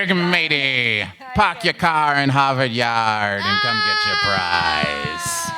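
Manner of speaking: slow, hammed-up pirate voice with a Boston accent; Boston accent pirate bellow. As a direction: shout it like a Boston sea dog. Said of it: "That was the Boston pirate".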